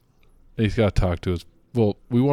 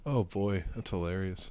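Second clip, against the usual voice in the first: soft mock chuckle; 10 dB quieter than usual. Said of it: "He's like".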